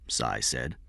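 plain reading voice

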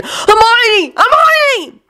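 high, desperate shout. A narrator's reading. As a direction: call out her name in panic. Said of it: "Ron shouted again".

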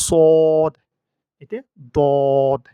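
সদ and দদ loud, with long rounded o; full-mouthed, held vowel on সদ, দদ; correct heavy letters. Shown as a demonstration. Teacher corrects a common mistake, loud sustained সদ and দদ. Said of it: "সিন উচ্চারণ করে ফেলি".

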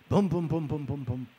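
quick rhythmic "boom boom" footfalls; imitated trotting footsteps. Being trotted blindfolded through the house, imitated trotting. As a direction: pound out the trot in booms.

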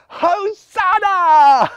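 huge high, drawn-out jubilant shout; happy shout. Acted. Healed blind man praises, shouting for joy. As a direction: shout it out slowly at full volume. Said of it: "so happy that he shouted".

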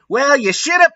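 very high squeak; squeaky joke take. Shown as a demonstration. Reader hamming up Ebony's retort. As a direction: squeak it up top as a gag. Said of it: "sorry, bad joke on my part".

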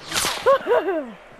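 nervous laugh. Real, not acted. Player laughs high after dodging a shot. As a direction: laugh off the fright.

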